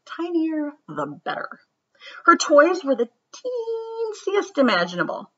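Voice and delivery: lively, sing-song storybook read-aloud; reading to children. A narrator's reading. Teacher reads a picture book to her class, lively and sing-song. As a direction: read it like story time for kids.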